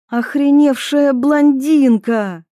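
barking shout. A narrator's reading. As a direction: bark the insult furiously. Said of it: "рявкнула Ива". (гневный окрик)

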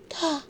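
high soft moan; moaning yes. A narrator's reading. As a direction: moan the answer. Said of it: "простонала девица".

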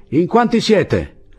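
slow, high sentry's call; drawn-out high question; shouted challenge. A narrator's reading. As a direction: call the question over the parapet. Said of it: "Poi la voce riprese".

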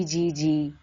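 usual speaking voice

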